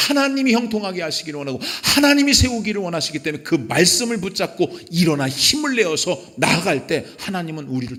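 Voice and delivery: high, urgent sermon delivery; fervent pulpit exhortation; impassioned preaching. Real, not acted. Preaches with raised voice.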